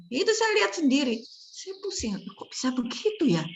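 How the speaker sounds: teacher telling students of the sloppy ward she saw; exasperated. Real, not acted. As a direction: rush it out in disbelief. Indignant about ward practice.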